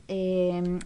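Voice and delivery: usual speaking voice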